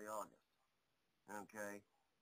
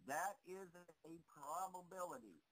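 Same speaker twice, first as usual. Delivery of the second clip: "probability" set apart after a pause; higher-pitched, deliberate "probability"; emphasis on probability; 5 semitones higher than usual. Real, not acted. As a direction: lean on "probability", drawing out the point. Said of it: "I now emphasize".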